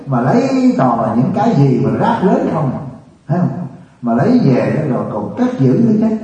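scolding monk laying into the crowd, preaching at full force; fiery preaching. Acted. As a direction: preach hard at the congregation, scolding. Monk scolding congregation in sermon.

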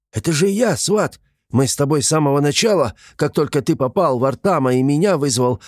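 high, urgent reminder; alarmed pleading. A narrator's reading. Gremlin reminding his forgetful friend who he is, alarmed. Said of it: "испугался простодушный коротышка".

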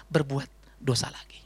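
slow, breathy whispered warning; hushed, slow warning whisper. Shown as a demonstration. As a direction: lean in and whisper it like a secret. Key phrase whispered.

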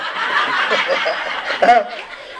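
airy silent laugh; breathless laugh. Real, not acted. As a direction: laugh under the breath at the question. Monk laughing at question.

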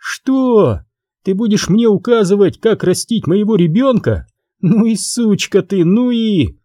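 high, wild and hostile; changed, unhinged voice. Acted. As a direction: snap at her like a madwoman. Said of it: "изменения в голосе очевидны".

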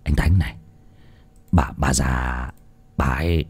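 quiet, emotionless telling of mother's death; indifferent. Acted. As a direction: report the death like it means nothing. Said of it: "nói rừng rưng như không có chút gì đau buồn".

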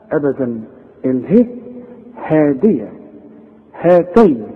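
ordinary talk, nothing marked

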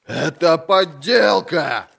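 high, slow, forceful roar; outraged roar. A narrator's reading. Lord protesting the evidence to the council, roaring. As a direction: roar it out in indignation. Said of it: "взревел лорд Брок".

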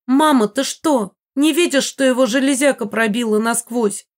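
high, loud, scandalised protest; indignant daughter. A narrator's reading. Анна Ри scolds her mother over the wounded man, high indignation. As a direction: snap at mother in outrage. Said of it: "возмутилась Анна Ри".

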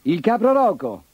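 harsh-voiced goat. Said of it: "con la sua voce aspra".

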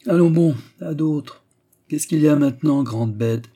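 loud, high gruff scolding; shouting steward. A narrator's reading. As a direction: bark at the weeping boy. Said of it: "cria Delvig".

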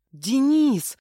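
high, loud exclamation of the name Денис; yelling. A narrator's reading. Heroine recognising the missing man, yelling. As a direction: yell it out in amazement. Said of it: "заорала я".

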